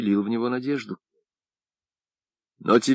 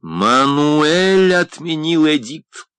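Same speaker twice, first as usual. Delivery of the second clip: slow, loud, high indignant announcement; indignant, very distinct; 6 semitones higher, 5 dB louder, much slower than usual. A narrator's reading. Friend tells the deaf painter of the edict, indignantly and very distinctly. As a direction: stretch each word out clearly in outrage. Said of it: "возмущённо и очень внятно произнёс Агустин".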